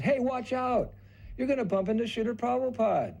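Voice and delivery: high, loud urgent warning; warning shout. Retold or imitated. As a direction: yell to stop him before he bumps in. Servant warns of a collision.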